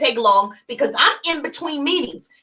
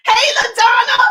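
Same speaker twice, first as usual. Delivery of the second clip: high, loud hey LaDonna; shouted bright hello; loud cheery greeting; 9 semitones higher, 5 dB louder than usual. Shown as a demonstration. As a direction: burst in warmly. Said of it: "It's her".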